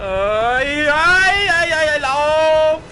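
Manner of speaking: high, loud panicked wailing; panicked flight from the brute; being chased. Real, not acted. Fleeing enemy in game.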